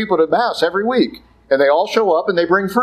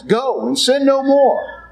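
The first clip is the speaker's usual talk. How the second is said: slow, high, solemn go and sin no more; solemn command; 9 semitones higher, slower than usual. Acted. Preacher quoting Jesus to the woman, slow and solemn. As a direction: lift it up slowly like a verdict. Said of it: "that's what Jesus says".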